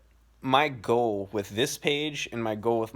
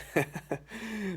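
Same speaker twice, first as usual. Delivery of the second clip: short laugh; 11 semitones higher, 7 dB quieter than usual. Retold or imitated. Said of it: "laughing so hard".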